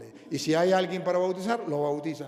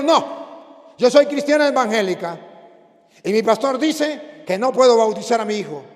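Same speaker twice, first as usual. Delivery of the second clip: higher, louder voice of a distressed mother; mother defends her pastor, higher and louder; pleading woman; 6 semitones higher, 11 dB louder than usual. Retold or imitated. Woman answers the priest.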